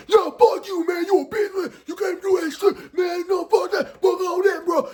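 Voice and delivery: high-pitched rapid-fire shouting; squealed, heated trash talk; mock cursing brawl. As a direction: imitate guys cussing each other out. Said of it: "they were cursing".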